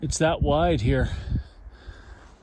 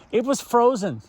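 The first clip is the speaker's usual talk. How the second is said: laughing; 6 semitones higher than usual. Real, not acted. Repeats with a laugh.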